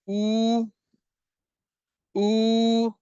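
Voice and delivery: "ऊ" drawn out for a long time; teacher demo of long ऊ. Shown as a demonstration. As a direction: stretch "ऊ" like a double o. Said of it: "बहुत देर तक खींच रहे हैं".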